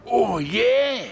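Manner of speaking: high, delighted "oh yeah"; excited whoop. Real, not acted. Walker cheering to viewers, excited. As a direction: whoop with joy at feeling good. Delighted mid-walk exclamation.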